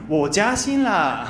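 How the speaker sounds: 我加薪了 said slowly with a learner's accent; Japanese-accented jiaxin. Shown as a demonstration. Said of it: "也会变成".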